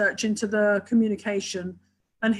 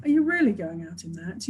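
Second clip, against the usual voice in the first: low and mild, just checking; gentle inflection; 5 semitones lower than usual. Shown as a demonstration. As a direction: ask it kindly, with no judgement. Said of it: "a whole different communication just by the inflection".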